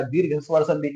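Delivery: ordinary talk, nothing marked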